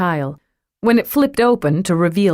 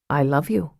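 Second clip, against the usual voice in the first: soft Irish lilt; 3 semitones lower, 4 dB quieter than usual. Acted. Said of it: "in that lovely voice that hinted of Irish mists".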